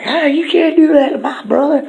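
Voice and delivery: high, loud squeaky protest; Riss outraged. Acted. Riss shouting at the lion tamer, high and loud. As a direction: squeal it in defence of your brother. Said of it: "Riss is like".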